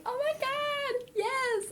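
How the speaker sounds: high, drawn-out squeal of a yes; thrilled proposal acceptance. Retold or imitated. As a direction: gush it very high, stretching every word. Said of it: "And she was like".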